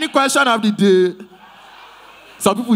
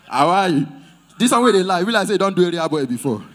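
ordinary talk

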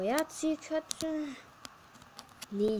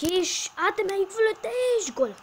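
high, loud goal cry; excited goal call; 11 semitones higher, 6 dB louder than usual. Real, not acted. Scoring goal in game.

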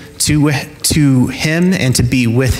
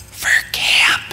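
put-on tiny whispered child answer; shy whisper. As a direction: mumble it shyly, barely voiced. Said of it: "and she's like".

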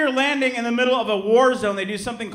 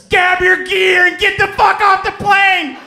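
high, loud, slow drill-sergeant scream; Marine screaming; 12 semitones higher, 10 dB louder, slower than usual. Retold or imitated. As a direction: bellow it like a Marine on landing. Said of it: "he screams".

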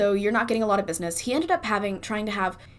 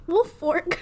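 put-on Southern debutante accent; 9 semitones higher than usual. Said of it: "I can't say this name in a Southern accent".

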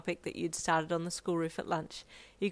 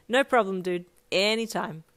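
cheerful; 7 dB louder than usual. Acted. Cheerful friend signing off.

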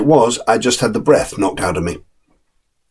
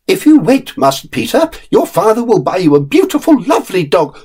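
higher, sweet-talking nurse's voice; coaxing nurse; 11 semitones higher than usual. Acted. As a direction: bribe the boy with a better dog. Nurse coaxes the boy.

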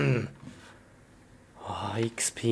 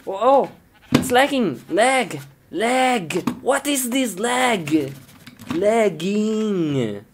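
alarmed; 7 semitones higher than usual. Real, not acted. Game starts lagging.

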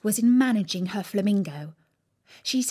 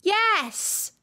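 mild shouted yes; 12 semitones higher than usual. A narrator's reading. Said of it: "shouted Alice".

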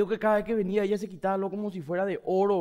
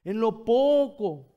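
"poco" stretched and louder, falling; slow emphasis; 4 semitones higher, 8 dB louder, much slower than usual. Shown as a demonstration. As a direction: linger on "En lo poco". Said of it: "repeats key phrase". ("poco" alargado y con fuerza)

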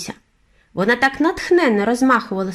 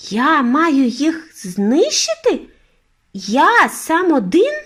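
put-on dismayed boy, much higher and louder, very slow; bewildered exclaim; 7 semitones higher, 4 dB louder, much slower than usual. A narrator's reading. Boy stunned by grandmother's task, bewildered. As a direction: cry out in disbelief. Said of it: "розгублено вигукнув я".

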